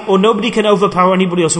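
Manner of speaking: ordinary delivery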